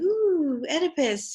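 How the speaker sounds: high, loud gushing ooh; mock swoon. Teacher mock-swooning over Oedipus, high and gushing. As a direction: swoon over the new husband.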